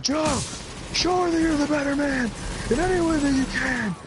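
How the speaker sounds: high, strained motivational shouting; impassioned pep talk. Retold or imitated. Rousing lovesick friend Joe to action, high impassioned shout. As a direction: re-enact a rousing drunken speech at full yell. Resumes inspirational speech.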